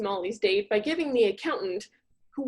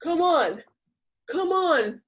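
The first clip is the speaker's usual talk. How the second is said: whiny pleading; 6 semitones higher, 3 dB louder, slower than usual. Acted. Accountant pushing for kiss.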